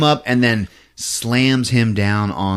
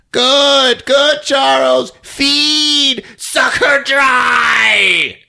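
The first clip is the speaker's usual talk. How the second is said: high, drawn-out gloating shout; hammed-up villainess building to a shriek; mock villainous mom; 14 semitones higher, 4 dB louder, much slower than usual. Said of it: "and she's like".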